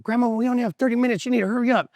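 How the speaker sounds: high, quick, hurried plea; acted rush, pitched well up; urgent pleading. Grandson in a rush at the market, urgent high pleading.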